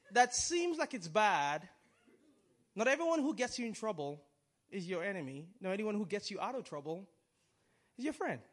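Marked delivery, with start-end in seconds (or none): none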